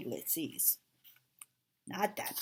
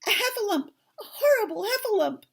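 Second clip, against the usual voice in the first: high, loud panicked cries; squealing alarm, very high and loud; terrified Piglet; 8 dB louder than usual. Acted. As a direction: cry for help in a tiny frightened voice. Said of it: "cried Piglet".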